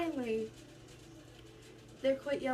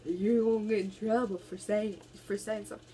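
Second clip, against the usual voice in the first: mocking internet commenters; 3 dB louder than usual. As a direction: parody the online critics. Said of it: "y'all on the internet saying".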